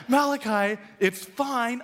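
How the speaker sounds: high, exasperated insistence; worked up. Retold or imitated. Dad snapping at his picky son, high exasperated plea. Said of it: "I'm all worked up".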